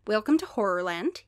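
rusty Scottish greeting; shaky try at a Scottish accent. Acted. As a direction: attempt a Scottish lilt. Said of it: "I'm gonna try to do Scottish".

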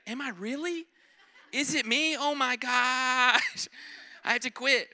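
high, loud, laughing mock panic; panicked self-doubt. Preacher imagining being called Satan, mock-panicking loudly. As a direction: spiral into comic worry. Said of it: "I'd be like".

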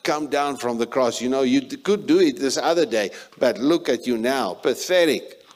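scornful taunting crowd; mocking jeer. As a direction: sneer at him like the crowd. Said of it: "even though they mock".

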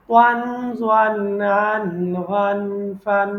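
punchy loud syllables ওয়ান, ফান with ringing n; ওয়ান ফান recited, each syllable hit loud; recitation demo. Shown as a demonstration. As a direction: chant the endings like tilawat. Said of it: "এটাই হচ্ছে তেলাওয্যাত".

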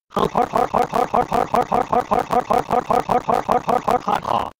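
long mocking cackle, ending high; evil laugh. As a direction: gloat with a villain's laugh. Naughty gloats over the user.

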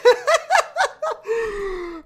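high, shrieking laugh; helpless high-pitched laughter; burst of laughter. Real, not acted.

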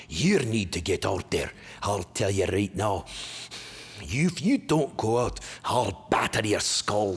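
slow, menacing Scottish tirade; broad Scots threats, heavy on each word; crazed Scottish stepfather. Retold or imitated. Imitating stepfather scolding a gaming kid, slow Scottish menace. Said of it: "My Scottish stepfather went crazy".

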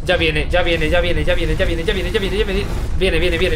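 loud, hurried repeated warning; nervous warning. Real, not acted. Streamer warning that the creature is coming, loud and nervous. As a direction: rattle it off as danger nears.

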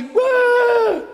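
high, loud squeal of a fan; mocking a fan's excited scream; mock fan shriek. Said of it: "and you say".

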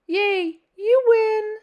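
excited high victory shout; cheering. Acted. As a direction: cheer the win out. Tree's cheering voice, louder.

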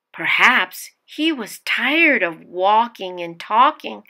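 usual speaking voice

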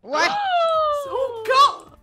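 surprised yell. Real, not acted. Sudden car appears in game.

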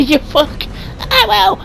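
ordinary delivery

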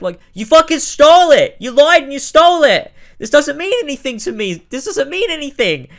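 high, loud exasperated rant; exasperated yell. Real, not acted. Ranting angrily at the game.

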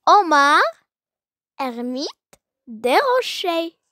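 measured classroom poem reading, punching each name; poem recitation. Acted. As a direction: recite it line by line with strong melodic swings and long pauses.

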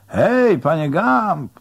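high, loud hail across water; calling out. A narrator's reading. Someone on the quay hailing a rower, calling out. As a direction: holler to catch someone's attention far away. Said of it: "zawołał ktoś stojący na nabrzeżu".

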